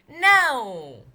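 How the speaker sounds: a sudden loud group shout; yelled no. Acted. Reporters shouting Nellie down at once, loud yell. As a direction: bark it out as the whole room. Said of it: "yelled at each other reporters at once".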